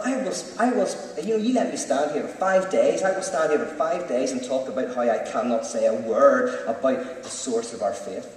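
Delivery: usual speaking voice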